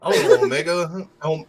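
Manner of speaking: ordinary talk, nothing marked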